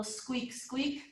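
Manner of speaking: soft little mouse squeaks; tiny mouse squeak. Shown as a demonstration. Yoga teacher showing kids the mouse pose, soft squeaking. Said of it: "make a little tiny mouse sound".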